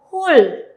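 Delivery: hul said loud and slightly high as a model; teacher models hul; syllable hul. Shown as a demonstration. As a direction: call out hul clearly for the class. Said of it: "Y leemos".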